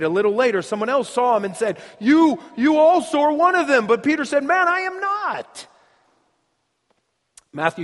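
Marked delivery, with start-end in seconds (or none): 2.0-3.9 high, loud pointed accusation. Acted. Onlooker singling Peter out in the courtyard, loud accusation. 4.4-5.7 high-pitched, indignant denial; heated denial. Acted. Peter denying he is one of them, high heated protest.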